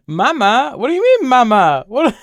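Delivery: high, loud baffled questions; confused surprise. Real, not acted. Streamer baffled by chat calling her Mama, high and loud. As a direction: sound genuinely baffled.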